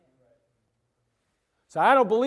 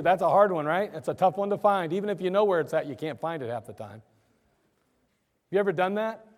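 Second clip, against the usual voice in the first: usual speaking voice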